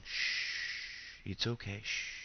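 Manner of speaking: soft whispered shh to soothe; shushing yourself quietly; rhythmic shushing. Real, not acted. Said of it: "calm down, calm down".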